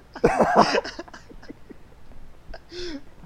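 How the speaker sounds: high wheezing laughter; breathless laugh. Real, not acted. Laughing at the accent.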